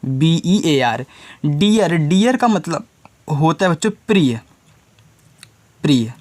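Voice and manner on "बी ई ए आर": बी ई ए आर letter by letter, drawn out; slow spelling. Shown as a demonstration. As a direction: spell each letter out slowly. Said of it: "भालू English में लिखना हो तो". (धीरे धीरे अक्षर बोलना)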